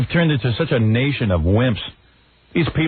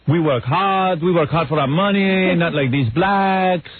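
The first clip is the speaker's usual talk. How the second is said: higher, hammed-up Jamaican accent; mock Jamaican accent; 6 semitones higher, slower than usual. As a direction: imitate a complaining Jamaican accent, drawing out the ending. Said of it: "the Jamaicans were always screaming".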